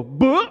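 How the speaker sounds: loud high crowd boo; hammed-up crowd booing; mock booing. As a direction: boo them down like an unhappy crowd. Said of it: "And the people go".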